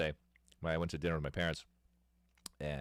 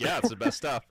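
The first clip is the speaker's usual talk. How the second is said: a bit higher and louder, selling it hard; lightly voiced hustling older friend; 6 semitones higher, 6 dB louder than usual. Older friend selling cheap beer to the kids, mock hype. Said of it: "And he's like".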